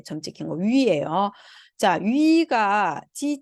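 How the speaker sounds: ordinary delivery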